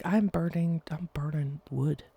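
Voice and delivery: ordinary talk, nothing marked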